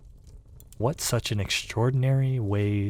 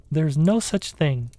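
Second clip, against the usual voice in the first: angry; 8 semitones higher, 5 dB louder than usual. A narrator's reading. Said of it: "beginning very angrily".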